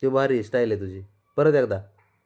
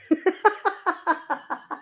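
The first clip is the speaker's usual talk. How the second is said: high laugh. Real, not acted. Parent laughing at baby. (उंच आवाजात हसणे)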